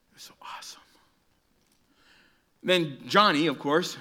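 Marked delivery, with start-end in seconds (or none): 0.1-0.8 awestruck whisper; hushed awe. Retold or imitated. Preacher telling kids of his childhood leap, awed whisper. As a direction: drop to a breathless whisper.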